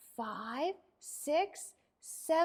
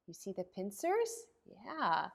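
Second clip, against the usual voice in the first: usual speaking voice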